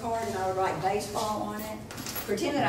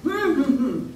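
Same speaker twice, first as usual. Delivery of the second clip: higher, louder muffled hum; closed-mouth humming; 5 semitones higher, 8 dB louder, slower than usual. Shown as a demonstration. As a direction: hum the word inside your mouth. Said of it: "say it inside your mouth".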